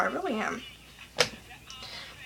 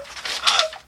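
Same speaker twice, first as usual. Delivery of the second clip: sharp high breathy gasp; quick delighted gasp; excited gasp. Retold or imitated. Gasp before velociraptor request.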